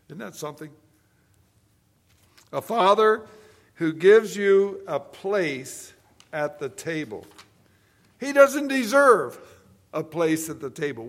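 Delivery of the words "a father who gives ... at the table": loud, emphatic pulpit delivery, dragging the phrases out; preaching at full force. Acted. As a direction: drive the point home to the congregation. Preaching the fourth point.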